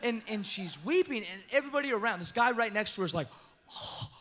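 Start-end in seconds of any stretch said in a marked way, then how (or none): 3.5-4.1 gasped, breathy little "oh"s; mock gasp. Man beside the weeping girl reacts, mock gasp. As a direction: imitate a stunned bystander's gasp. Bystander gasps at the word.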